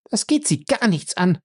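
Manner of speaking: high, scornful woman's retort; haughty, high rebuff; contemptuous. A narrator's reading. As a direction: throw it back with disdain, high-pitched. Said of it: "erwiderte sie verächtlich".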